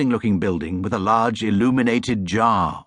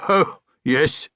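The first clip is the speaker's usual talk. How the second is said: dry sarcastic "oh, yes"; mock the bear with disbelief; mildly sarcastic gatekeeper; 6 semitones higher than usual. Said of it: "said the gatekeeper sarcastically".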